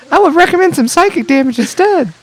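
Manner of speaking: very high, chipper helper voice; wholesome 1950s kid. Acted. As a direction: chirp it like an eager assistant. Clippy parody continues.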